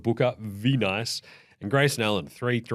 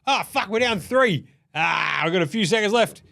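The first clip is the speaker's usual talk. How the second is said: mock panicked player, pitched up; Jimmy Butler voice; 7 semitones higher than usual. Podcaster mocking Jimmy Butler's final possession, hammed-up panic. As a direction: play the frantic star fumbling the last shot. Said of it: "Jimmy Butler's like".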